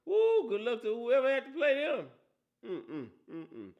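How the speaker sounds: ordinary delivery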